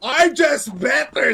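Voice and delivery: loud high shouting hype; esports caster. Real, not acted. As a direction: yell every kill as it lands. Caster calls a round-winning multikill.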